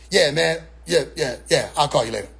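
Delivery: low, dismissive acted reply; brush-off. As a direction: hurry them off the phone, uninterested. Acting friend brushing caller off.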